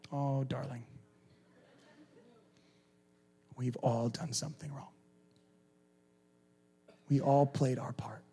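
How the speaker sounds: soft, dropping to a whisper; tender hush. Real, not acted. Pastor answering a defensive listener, tender and hushed. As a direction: comfort them gently.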